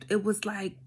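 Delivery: normal talking voice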